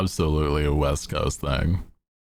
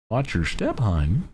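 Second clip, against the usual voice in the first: higher, homey Midwestern mom voice; light Midwestern accent, a touch higher; 3 semitones higher than usual. Shown as a demonstration. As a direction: warn her like a fussing Midwestern aunt. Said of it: "I don't think I even do a good, like, Midwestern accent".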